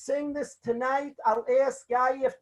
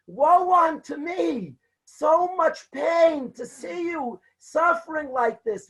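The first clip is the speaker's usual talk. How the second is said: anguished cry; 4 semitones higher than usual. Retold or imitated. Father-in-law weeping at his son-in-law's pain, anguished cry. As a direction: wail it like a grieving elder. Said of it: "cries out in anguish".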